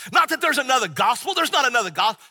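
high and loud insistence; preacher voicing Paul's passionate protest. Shown as a demonstration. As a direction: pound it home with fervour. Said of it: "He's probably getting very passionate".

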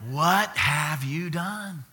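a grandparent's slow, aghast question; stern grandparent. Retold or imitated. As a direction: stretch each word in mock disbelief. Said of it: "What do you think I said to him?".

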